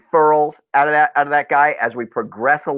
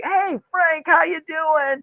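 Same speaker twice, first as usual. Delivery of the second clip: pitched-up, friendly mock client greeting; cheery client; 13 semitones higher, much slower than usual. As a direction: play the old client happy to hear from Frank. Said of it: "they're like".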